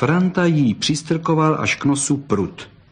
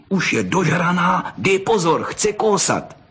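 higher, hurried warning to a friend; alarmed boy warning; urgent warning; 6 semitones higher than usual. Acted. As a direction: warn him she's about to bite. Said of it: "napomenutí".

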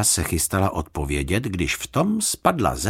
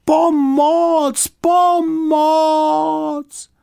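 high, loud shouted call for help; a bear in the bathtub shouting for help; loud cry for help; 6 dB louder, much slower than usual. Acted. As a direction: yell for help as loud as you can. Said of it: "a potom pořádně nahlas".

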